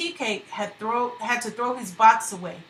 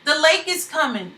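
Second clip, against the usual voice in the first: raised, alarmed cry; 6 semitones higher, 5 dB louder, faster than usual. Acted. Fugitives warning shanty neighbors of the flood, crying out. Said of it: "They cried out as best they could".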